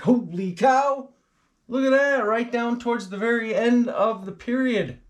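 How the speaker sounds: high, loud exclamation; excited amazement. Acted. Presenter spotting another storm on the map, excited amazement. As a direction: burst out as if stunned by the discovery.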